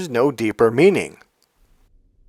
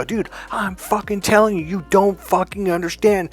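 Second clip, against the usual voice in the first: retold scream; 7 semitones higher than usual. Coach telling stubborn client off, mock scream. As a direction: imitate screaming in exasperation, pitched up. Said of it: "I had to scream".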